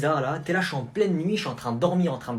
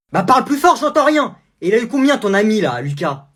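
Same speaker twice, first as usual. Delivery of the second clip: loud, higher furious mom; angry mother; 7 semitones higher, 9 dB louder than usual. Acted. Mother yelling at her son over his grade. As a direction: demand an answer about the grade.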